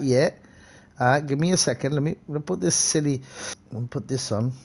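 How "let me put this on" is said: ordinary talk, nothing marked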